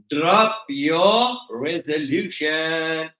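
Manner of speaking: "Drop your resolution" very slow, every word stretched; slow emphatic repeat. Shown as a demonstration.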